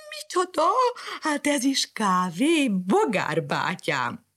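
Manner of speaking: high, slow sarcastic cat; mocking cat. Cat mocking the coffee to the dog. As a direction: drag it out mockingly.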